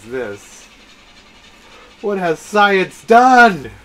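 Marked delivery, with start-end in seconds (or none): 2.0-3.7 slow, loud, high dramatic cry; drawn-out shout of wonder; awed exclamation. Real, not acted. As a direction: proclaim it in overblown amazement. Mock awe at new technology.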